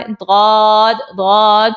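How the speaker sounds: ضاد long and heavy, tongue side on molars; Arabic dad. Shown as a demonstration. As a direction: draw out ضاد as a thick "dawd". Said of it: "داڑھوں سے لگانا ہے".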